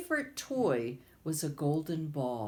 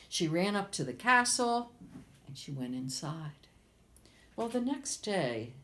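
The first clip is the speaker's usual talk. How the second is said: ordinary delivery, nothing marked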